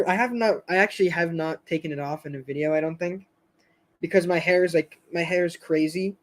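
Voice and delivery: ordinary talk, nothing marked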